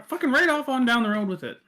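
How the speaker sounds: high, loud, hurried grumble; exasperated Death. Acted. Death finishing his rant at the two, high fast exasperated voice.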